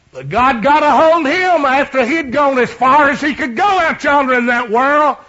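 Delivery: loud, high preacher's shout; full-force preaching. Acted. Preacher to congregation, loud high preaching shout. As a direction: preach it out to the whole church.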